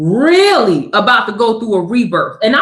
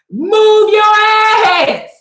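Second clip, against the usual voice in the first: high, very slow barked yell; drill sergeant yell; 7 semitones higher, much slower than usual. Retold or imitated. Drill sergeant yelling at a lagging recruit, a slow barked shout. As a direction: bellow it like a drill instructor on a run. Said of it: "the first shirt behind me talking about".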